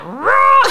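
loud, high mock roar; losing-it roar. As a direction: imitate a child exploding in disgust. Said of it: "she's lost it".

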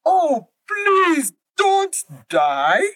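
high, slow, anxious cry; pleading husband. Acted. Husband alligator begging his wife not to die, high anxious cry. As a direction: beg her not to die.

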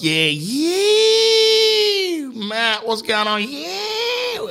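very high, drawn-out falsetto whoops; excited mate greeting. Retold or imitated. Stoner mates hail Matt walking in, high whooping mock greeting. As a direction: squeal the hello, stretching each "yeah".

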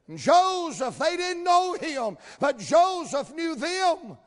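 loud, high, slowed shout; rising revival shout; preaching shout. Acted. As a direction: hammer home the line. Preacher climaxes on a key line.

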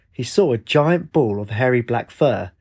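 ordinary delivery, nothing marked

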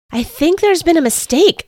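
high, loud, urgent blurt. A narrator's reading. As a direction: blurt it out in a panic. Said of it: "I blurted out".

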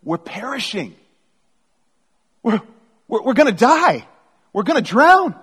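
high, loud cry of alarm; acted desperate plea, pitched high; panicked cry. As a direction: build from alarm to frantic shouting. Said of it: "they didn't say it that, that way at all".

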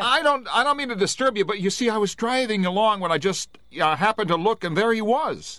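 high, loud, fast pleading; frightened pleading. Acted. Driver pleading with the stand owner, scared and high. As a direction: beg for help with mounting fear.

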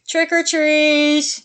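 drawn-out sing-song trick or treat; child trick-or-treating. Pretend. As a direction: call it out like an eager kid. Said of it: "So let's do this".

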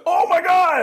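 high excited gasp of fans; mock fan squeal.

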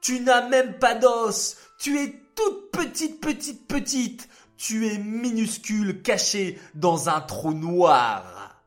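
high sing-song jeering; mocking taunt. A narrator's reading. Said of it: "ricanèrent les parties du corps".

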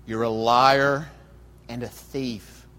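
slow accusing creature voice, swelling on "liar"; Gollum. Acted. Sinister creature accusing his other self, slow and menacing. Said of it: "switching back and forth".

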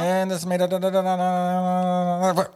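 hammed-up auctioneer with nonsense syllables. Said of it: "Do you have to be like an auctioneer?".

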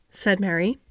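plain reading voice

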